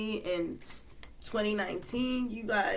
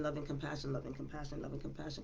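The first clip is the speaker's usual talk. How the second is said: fading mantra; 5 semitones lower, 8 dB quieter, faster than usual. Real, not acted. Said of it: "repeated mantra fading".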